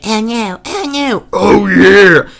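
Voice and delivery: mock Kool-Aid Man bursting in. Shown as a demonstration. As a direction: build to a big loud OH YEAH. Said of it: "Or maybe Kool-Aid Man".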